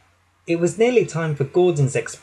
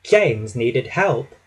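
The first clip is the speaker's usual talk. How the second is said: usual speaking voice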